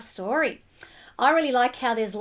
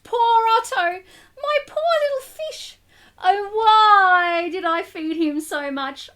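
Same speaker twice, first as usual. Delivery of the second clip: dismayed lament; 6 semitones higher, 5 dB louder than usual. A narrator's reading. Narrator boy laments growing fish.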